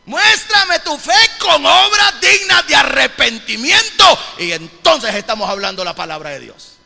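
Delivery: loud, high-pitched preacher's proclamation; emphatic pulpit delivery; fervent preaching. Real, not acted. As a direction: preach it out with full force. Preaching at full force.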